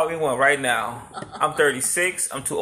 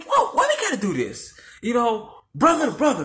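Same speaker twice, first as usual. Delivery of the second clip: mock pleading, pitched way up, laughing; pleading high voice; 9 semitones higher than usual. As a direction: beg him high and breathless through laughter. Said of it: "I'm like".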